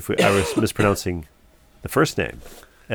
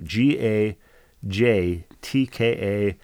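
normal talking voice

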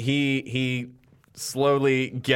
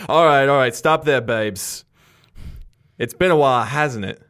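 loud, cocky greeting in a higher voice; Skid's greeting; 3 semitones higher, 6 dB louder than usual. Acted. Ghost villain greets the detective, loud and cocky. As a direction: swagger up and call out to the detective. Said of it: "this figure turns and goes".

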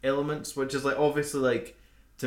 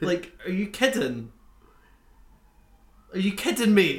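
louder, higher disbelief; exasperated at the stranger; incredulous rant; 7 semitones higher, 3 dB louder than usual. Retold or imitated. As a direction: demand it in disbelief. Ranting at cinema stranger.

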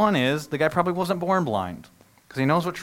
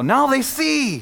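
excited cheer; 11 semitones higher, 7 dB louder, much slower than usual. Retold or imitated. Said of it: "you're gonna go".

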